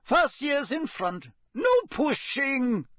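high, slow, drawn-out command; strict teacher marshalling children; Professor McGonagall. A narrator's reading. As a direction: call out orders to lines of pupils. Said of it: "said Professor McGonagall".

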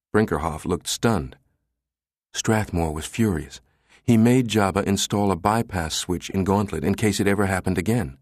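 ordinary delivery, nothing marked

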